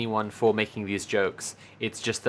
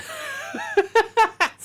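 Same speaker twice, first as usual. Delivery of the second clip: squealing laugh, high and loud; real laugh breaking out high; high burst of laughter; 5 dB louder than usual. Real, not acted. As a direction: let out a sudden shrieking laugh. Laughing at her own joke.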